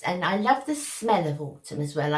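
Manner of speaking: normal talking voice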